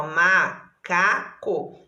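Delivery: macaco slowly split, ma-ca-co; syllable by syllable. Shown as a demonstration. Teacher giving a three-syllable example, syllable by syllable. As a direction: give each syllable of macaco its own beat. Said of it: "uma palavra que tem três sílabas".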